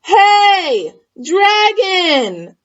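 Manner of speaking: high, loud call; shouted. Acted. Elizabeth shouts into the sleeping dragon's ear. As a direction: yell it into his ear. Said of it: "She shouted as loud as she could".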